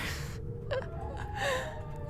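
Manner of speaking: nervous laugh-cry. Real, not acted. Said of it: "I'm starting to cry".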